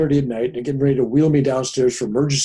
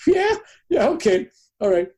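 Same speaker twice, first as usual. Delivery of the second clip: high, humoring yeah yeah; dismissive wife; 11 semitones higher than usual. Retold or imitated. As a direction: brush him off like a doubting wife. Wife humoring his boast.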